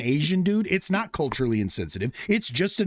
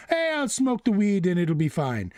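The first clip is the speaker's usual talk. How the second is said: put-on Rastafarian accent; 4 semitones higher than usual. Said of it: "I was about to do the accent".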